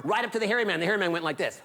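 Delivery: ordinary delivery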